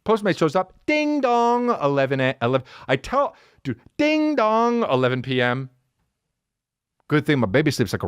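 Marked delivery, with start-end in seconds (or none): 0.9-1.7 high sung-out ding dong; imitated doorbell. Teller imitates the late-night Postmates doorbell, high chiming ding dong. 4.0-4.8 high sung-out ding dong; imitated doorbell. Teller imitates the late-night Postmates doorbell, high chiming ding dong.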